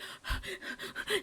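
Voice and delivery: high breathless mock gasp; excited gasp. Boyfriend waiting outside her apartment, a giddy breathless gasp. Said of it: "And he's like".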